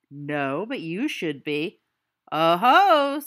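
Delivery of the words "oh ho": mocking oh-ho. Mocking a friend's refusal.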